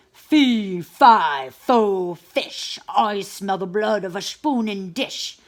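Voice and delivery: loud, booming giant voice. A narrator's reading. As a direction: boom like a giant. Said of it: "a voice boomed".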